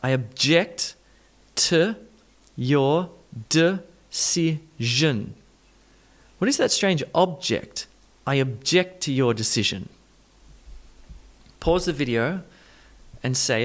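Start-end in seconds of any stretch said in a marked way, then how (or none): 0.0-5.4 slow, separated: uh-JECT, tuh, de-CI-sion; broken-down schwas. Shown as a demonstration. Teacher breaks down the sentence, slow schwa syllables. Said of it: "look at it with this schwa sound". 6.4-9.9 sing-song shift of stress across "object"; stress-shift melody. Shown as a demonstration. Teacher models the melody, shifting stress. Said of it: "if you can get that melody".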